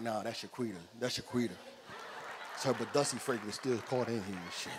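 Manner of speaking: a low, embarrassed admission; sheepish excuse. Retold or imitated.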